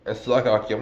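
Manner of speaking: normal talking voice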